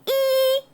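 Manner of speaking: high, loud, held "ee"; long ee. Shown as a demonstration. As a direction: cheeks pulled back into a sustained high "ee". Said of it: "like you're pulling your cheeks back".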